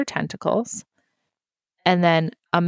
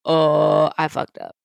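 sheepish realization; 3 semitones lower than usual. Retold or imitated. Voicing his face after she's pissed, sheepish realization. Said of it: "this face that's like".

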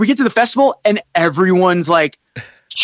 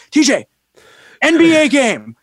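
higher-pitched imitation of a telling-off; reproachful call; 7 semitones higher than usual. Tour mate hushing him during the game, reproachful imitation.

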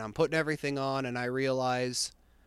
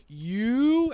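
exasperated; 11 semitones higher, 5 dB louder than usual. Retold or imitated. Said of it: "I wanted to curse him out".

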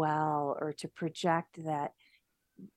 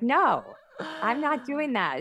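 high, drawn-out refusal; firm refusal; 7 semitones higher, 3 dB louder than usual. Acted. Telling a guilty thought no, firmly. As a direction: tell it no, high and slow. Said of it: "telling it no".

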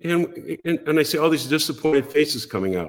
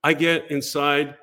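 usual speaking voice

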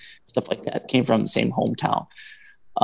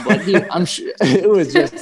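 laughing; 5 semitones higher, 5 dB louder than usual. Real, not acted. Embarrassed laugh telling story.

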